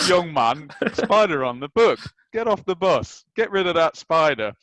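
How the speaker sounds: a mock-stern old manager shooing a kid off the bus; Brian Clough voice. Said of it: "Perfect, there you go".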